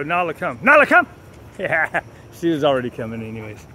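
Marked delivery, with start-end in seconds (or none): 0.6-1.1 much higher, loud shouted call; mimicking him hollering for the dog; mock yell. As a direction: bellow the dog's name in jest. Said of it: "he would go".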